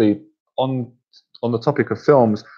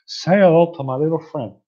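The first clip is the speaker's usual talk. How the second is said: higher-pitched mock gangster catchphrase, held back from a shout; Scarface impression; 5 semitones higher than usual. Impressionist giving the famous line, mock Cuban gangster. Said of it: "we're impressionists".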